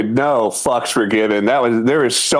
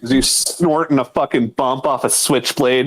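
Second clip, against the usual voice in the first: ordinary delivery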